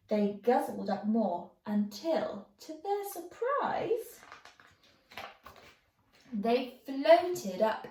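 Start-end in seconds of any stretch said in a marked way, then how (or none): none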